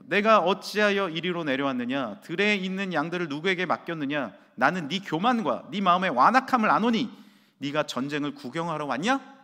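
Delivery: put-on loud, scornful older-brother taunt; mocking scorn. Older brothers taunting David, loud and mocking. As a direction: sneer down at the little brother. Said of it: "라고 조롱하고".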